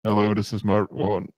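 low robot voice. Shown as a demonstration. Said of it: "I could also be like".

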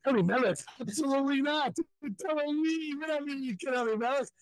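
high, outraged wife refusing the name; scandalised wife flatly refusing; indignant wife. Retold or imitated. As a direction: play the shocked wife, pitched well up.

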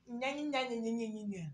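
dismissive soft babbling mockery; mocking nya nya. Friend mocking the girl's excuses, soft scornful nya nya. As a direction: mimic her nonsense with scorn.

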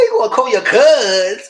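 loud, high laughing disbelief; mock incredulous. Teasing the man about calling his cousin, loudly incredulous. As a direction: call him out, loud and amused.

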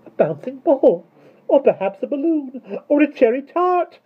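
very high, loud glee; sweet, delighted cry. A narrator's reading. Miss Tartlett twirling in her new voice, gleeful. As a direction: exclaim in giddy delight. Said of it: "cried Miss Tartlett's new voice".

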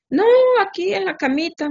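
imitating a pitiful sick husband in bed; whiny husband. Wife mimicking husband's whine from bed, mock pitiful.

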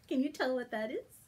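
ordinary delivery, nothing marked

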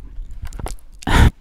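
a short breathy giggle; whispered giggle. Real, not acted. Host laughs at awkward moment, breathy giggle.

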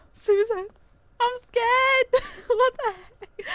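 high, loud frightened cry; giggly high-pitched terror; scared squeal. Real, not acted.

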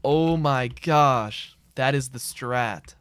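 very slow southern drawl; put-on southern accent. Streamer excited by his southern plan, slow southern drawl. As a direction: draw every word out in a mock southern accent. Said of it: "I wish I had a southern accent".

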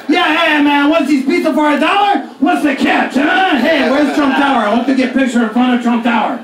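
Euro trash voice. Pretend. Comic playing a Eurotrash tourist in New York, hammed-up accent. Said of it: "I'm really good at Euro trash".

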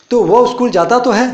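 "वो" hit high and firm, "तो है" insisted; emphatic. Shown as a demonstration. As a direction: insist that he does go to school. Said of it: "जोर दे के मैं बोल रहा हूँ". (ज़ोर देकर, "वो" ऊँचा और दबाकर)

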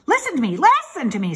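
loud, high, insistent goose; mildly screamed plea to be heard; goose's scream. A narrator's reading. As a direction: demand attention in a screaming goose voice. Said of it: "screamed the goose".

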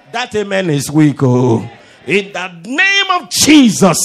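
booming preacher's shout; rousing shout. Real, not acted. Preacher rouses congregation after a weak amen, full-throated shout.

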